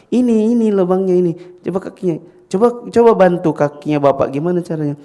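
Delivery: high and loud, urging; eager children. Acted. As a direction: help dad eagerly. Children help their father.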